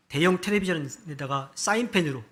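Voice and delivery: ordinary delivery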